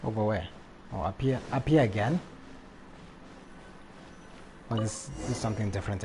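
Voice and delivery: ordinary delivery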